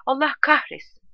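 high, angry narrated curse; furious cry. A narrator's reading. Soldier cursing his burning eyes, furious cry. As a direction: curse in rage, pitched well up. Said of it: "Kuduz bir öfkeyle, / diye haykırdı".

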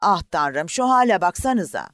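loud, high horrified exclamation; horrified cry. Acted. An onlooker in the boat crying out in horror at the sinking. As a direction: cry out in shock at the sight.

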